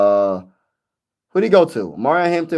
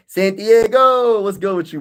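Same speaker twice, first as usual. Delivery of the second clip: called-out name; 8 semitones higher, 4 dB louder, slower than usual. Real, not acted. Calling out to a viewer.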